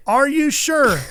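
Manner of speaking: high, emphatic double-check; John Force. Retold or imitated. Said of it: "turns back to Felix".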